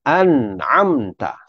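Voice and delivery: an'amta slow, nun dead and unbounced; correct an'amta. Shown as a demonstration. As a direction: say an'amta slowly, nun closed. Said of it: "Hendaklah dibaca". (an'amta dengan nun mati, perlahan)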